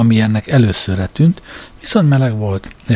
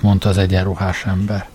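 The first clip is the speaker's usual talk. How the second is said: plain reading voice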